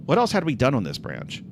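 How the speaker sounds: ordinary talk, nothing marked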